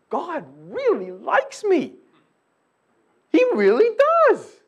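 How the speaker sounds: high, loud, delighted testimony; joyful declaration. Retold or imitated. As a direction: burst with happy certainty. Voicing a believer's prayer.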